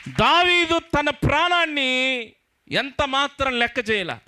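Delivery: high, slow, ringing proclamation; fervent pulpit cry; soaring preaching. Acted. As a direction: lift the voice up high and stretch out దావీదు. Preacher exalts David's courage.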